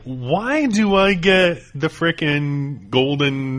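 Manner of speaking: slow, drawn-out mock complaint; mock indignant. Real, not acted. Host playfully protesting being cast as C-3PO, mock outrage. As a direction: stretch it out in fake outrage.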